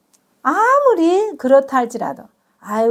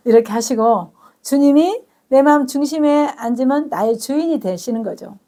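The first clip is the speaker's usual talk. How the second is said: usual speaking voice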